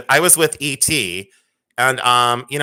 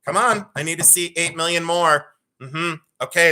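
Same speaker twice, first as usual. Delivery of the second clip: ordinary talk, nothing marked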